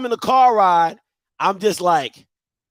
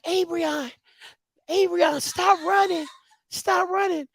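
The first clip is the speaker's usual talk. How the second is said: much higher, calling-out mom voice; imitated mother calling; 9 semitones higher, 4 dB quieter, slower than usual. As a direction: call after a runaway child, without shouting. Mother calls after running child.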